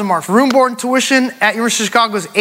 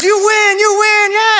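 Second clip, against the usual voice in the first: high-pitched mock announcer celebration; thrilled contest-host cry; excited radio host; 11 semitones higher, slower than usual. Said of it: "And the radio guy goes".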